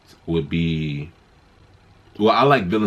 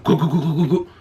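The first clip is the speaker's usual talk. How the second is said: whoosh sound; 8 semitones higher, faster than usual. Retold or imitated. Imitating Stormbreaker flying in.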